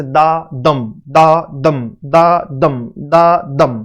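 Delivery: unstressed "दा", stressed "दम", slow and low, in steady pairs; iambic stress rhythm. Shown as a demonstration. Teacher demonstrating the rhythm of iambs to students, slow stressed chant. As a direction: chant "दा दम" as a slow, low weak-strong beat. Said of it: "उसकी लय कैसे लिखी जाती है".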